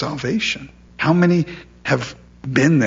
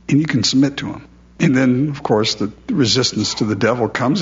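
ordinary talk

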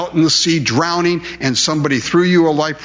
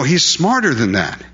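usual speaking voice

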